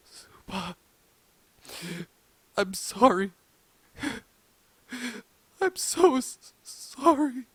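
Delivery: high, broken sobs between words; sobbing. A narrator's reading. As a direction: sob out the apology, halting and high-pitched. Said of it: "He then began to sob".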